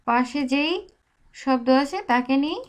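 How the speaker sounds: usual speaking voice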